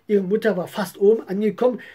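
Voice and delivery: usual speaking voice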